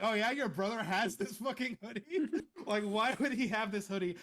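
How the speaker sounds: high-pitched, baffled protest; mock incredulous. Retelling his reaction to the kiosk guy, mock incredulous. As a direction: challenge it in amused disbelief.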